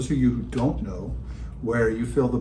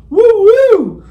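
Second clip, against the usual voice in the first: excited high cheer; 13 dB louder than usual. Real, not acted. Cheering new growth.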